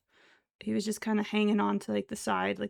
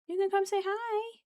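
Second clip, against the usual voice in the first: higher pet talk; 11 semitones higher than usual. Real, not acted. Cooing to pet, repeated higher.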